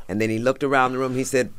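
usual speaking voice

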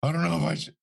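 high, strained mock-tearful voice; imitated crying. Cornered bodyguard wavering before his interrogator, imitated tearfulness. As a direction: imitate a torn prisoner near tears. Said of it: "crying. He finally looks at me".

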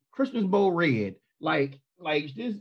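ordinary delivery

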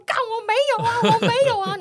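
high, frantic protest on the phone; panicked denial. Retold or imitated. Retold panicky denial to a friend on the phone, high and frantic. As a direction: insist you didn't, voice shooting up in alarm. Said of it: "然后我就说".

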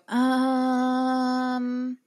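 drawn-out hesitant um; mock filler um. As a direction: imitate a rambling podcaster. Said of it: "nobody wants to listen to us go".

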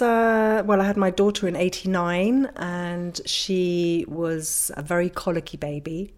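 ordinary talk

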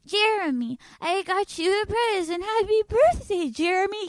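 hammed-up sweet high voice; mock eager girlfriend. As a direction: gush at him like a clingy girlfriend.

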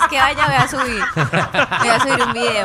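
laughing. Real, not acted. Laughing joke about climbing.

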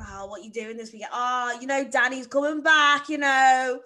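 lightly voiced Australian accent; teasing Aussie mates impression; mock Aussie mates. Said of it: "your mates have".

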